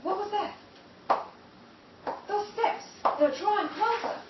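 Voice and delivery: loud, high, panicked; rising terror. Acted. Ida hearing footsteps draw closer in the dark, terrified. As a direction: let fear climb as the steps come near.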